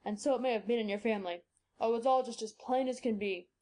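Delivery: put-on low old man's voice, sure of himself; reassuring her it all adds up; confident old doctor. Doctor explains possible reasons.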